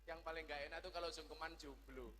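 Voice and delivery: normal talking voice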